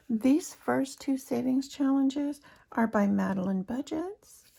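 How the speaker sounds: ordinary talk, nothing marked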